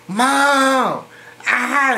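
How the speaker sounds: high drawn-out cry, held back in volume; retold scream for mom. Child screaming for mom at night, re-enacted scream. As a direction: imitate a child screaming out for help. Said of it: "I screamed so many times".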